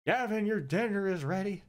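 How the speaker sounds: high calling mother voice; Principal Skinner's mother. Acted. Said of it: "I kinda read that in Principal Skinner's voice".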